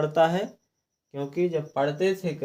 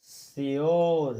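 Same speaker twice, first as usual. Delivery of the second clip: Sure drawn out slowly as a model answer; slow model word; much slower than usual. Shown as a demonstration. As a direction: stretch out sure. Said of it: "तो हम इसको क्या पढ़ेंगे?". (Sure को धीरे-धीरे खींचकर बोलें)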